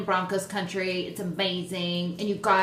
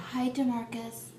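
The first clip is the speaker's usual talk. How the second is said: soft, hushed little greeting; lightly re-enacted hush; half-whisper; 3 semitones higher, 6 dB quieter than usual. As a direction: imitate a discreet, near-whispered hello. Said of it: "I kinda whispered".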